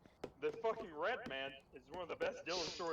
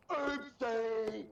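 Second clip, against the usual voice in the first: louder mock blurt of a silly kid; goofy classmate; 2 semitones higher, 5 dB louder than usual. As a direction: call it out, imitating the goofball. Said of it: "he stood up and goes".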